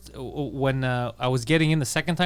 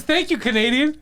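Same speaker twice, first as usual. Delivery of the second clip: laughing quote; 8 semitones higher than usual. Retold or imitated. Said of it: "The best is".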